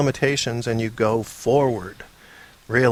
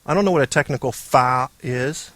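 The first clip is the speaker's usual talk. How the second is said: ordinary talk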